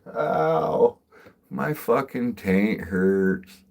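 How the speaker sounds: loud, high "Ow", then slow drawn-out complaint; mock pained whine. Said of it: "I'm like".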